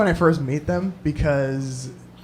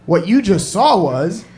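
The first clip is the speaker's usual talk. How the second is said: ordinary talk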